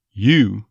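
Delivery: "you" said as a full, stressed "you". Shown as a demonstration. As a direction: lean on "you".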